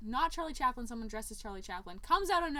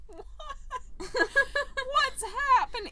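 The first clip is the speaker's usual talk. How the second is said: mock confusion; 8 dB louder, slower than usual. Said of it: "and you're like".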